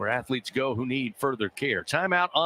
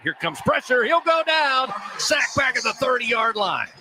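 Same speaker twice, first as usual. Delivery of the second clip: high, loud rising play call; excited sack call; 10 semitones higher, 5 dB louder than usual. Real, not acted. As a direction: shout it as the sack lands.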